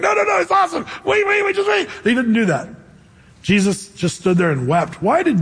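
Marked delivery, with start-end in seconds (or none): 0.0-1.9 very high, eager mock protest; squealed, playful pleading to hold on; excited reassurance, hammed up. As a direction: cut in on the weeping, pitched way up. Acts Jesus hushing weepers with good news.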